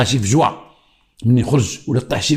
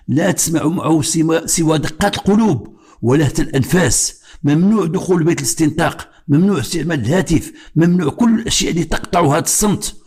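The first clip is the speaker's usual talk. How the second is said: slow, higher-pitched solemn declamation; formal written reading; 4 semitones higher than usual. Acted. Retired policeman reading a text on interrogation, formal declamation. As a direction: read it out with deliberate, stretched phrase endings.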